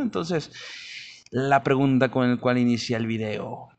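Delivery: usual speaking voice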